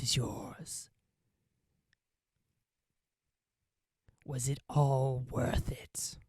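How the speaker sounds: slow, tempting whisper in the ear; a sly little voice coaxing betrayal; tiny whispering voice. Acted. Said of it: "you hear a tiny little voice in your ear".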